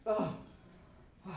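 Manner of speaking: normal talking voice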